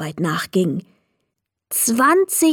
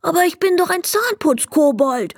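higher, plaintive goblin voice; a goblin afraid of losing his job; sad goblin; 9 semitones higher than usual. Acted. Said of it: "fragte er traurig". (trauriger Kobold, höhere Stimme)

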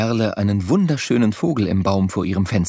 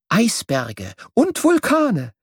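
slow list; 9 semitones higher, slower than usual. A narrator's reading. Listing wonders, then repeated.

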